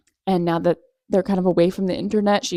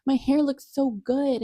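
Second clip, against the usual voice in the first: mocking quote; 6 semitones higher, 4 dB quieter than usual. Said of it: "she's like".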